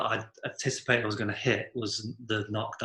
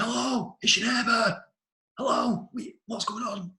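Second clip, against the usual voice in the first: high, northern English call, rising at the end; northern English holler through a door; really northern English; 11 semitones higher than usual. Retold or imitated. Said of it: "I went really so northern".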